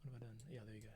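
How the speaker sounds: ordinary talk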